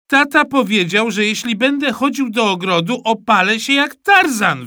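put-on high, earnest child's voice; child explaining. Mikołaj explains to his mother, high and earnest. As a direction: plead your case like a small boy. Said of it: "wyjaśniłem".

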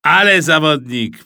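loud, admiring outburst; impressed exclamation. A narrator's reading. Tata podziwia zwycięzcę quizu. (głośny okrzyk podziwu)

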